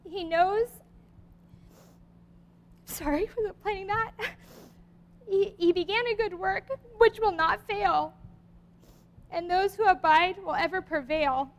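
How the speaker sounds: fighting back tears to finish the poem; choking up. Real, not acted. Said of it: "sorry that I, uh, got emotional".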